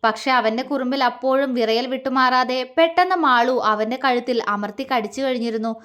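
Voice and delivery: plain reading voice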